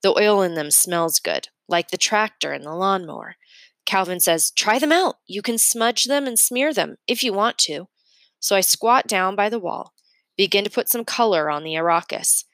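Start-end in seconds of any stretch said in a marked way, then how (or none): 4.5-7.9 put-on a higher, lighter boy's voice, encouraging; Calvin's voice. Calvin urges Mason to try the oil crayons, higher and encouraging. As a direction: coax a friend to try the crayons.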